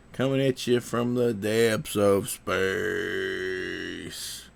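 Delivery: drawn-out sci-fi movie announcer; old sci-fi announcer. Acted. Dramatic space intro line.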